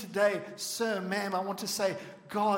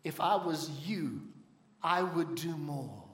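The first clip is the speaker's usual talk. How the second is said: lower, softer and slow, a gentle voice of God; a calm divine answer handed back; lightly voiced God, lower and slow; 4 semitones lower, 5 dB quieter than usual. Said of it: "God said to him".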